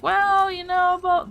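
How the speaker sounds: mocking therapist. Mimicking therapists who never explained it, mock hedging. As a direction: mimic a vague, dodging therapist.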